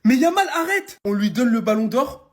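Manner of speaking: high, loud outburst at the player; exasperated shout. Acted. Fan yelling at Yamal to stop, exasperated shout. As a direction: shout it in mock protest, pitch shooting up. Exclamation adressée au joueur.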